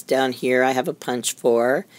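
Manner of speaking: ordinary delivery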